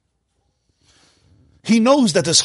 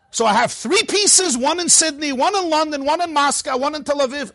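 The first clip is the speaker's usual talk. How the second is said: laughing through it; 7 semitones higher than usual. Acted. Rabbi telling the suitcase joke, laughing and higher. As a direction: savour the joke's punchline.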